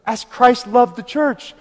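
preaching at full force. Acted. Pastor exhorting his congregation, in full preaching cry. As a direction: thunder it out to the whole church.

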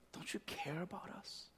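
frightened disciples whispering to Jesus; pleading whisper. Retold or imitated. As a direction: whisper the plea as if scared.

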